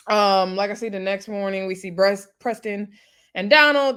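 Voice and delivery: ordinary talk, nothing marked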